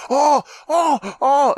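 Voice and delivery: high mock cries of awe; rising fanboy oohs, hammed up; overexcited fan. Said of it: "he goes".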